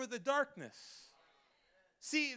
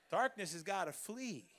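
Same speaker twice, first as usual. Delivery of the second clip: normal talking voice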